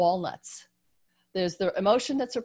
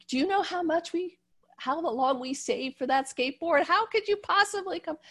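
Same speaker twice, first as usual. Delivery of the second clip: high-pitched scolding cry, much higher; mother yelling; 10 semitones higher than usual. Retold or imitated. Stressed mother yells at son over lost skateboard, high angry scolding. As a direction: shriek it like a frazzled mom scolding. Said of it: "she yells at him".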